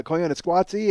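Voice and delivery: ordinary talk, nothing marked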